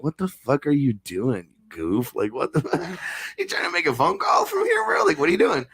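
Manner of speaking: high teasing voice, laughing through; mocking a friend. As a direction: rib the goof on his phone. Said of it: "I'm like".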